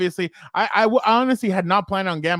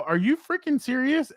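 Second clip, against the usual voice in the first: retold disbelief; 9 semitones higher than usual. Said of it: "I look at him and I go".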